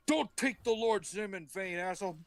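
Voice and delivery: high-pitched comic scolding; mock angry rebuke. God rebuking Cain for swearing, mock anger. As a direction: scold him in hammed-up outrage.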